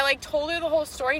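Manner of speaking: normal talking voice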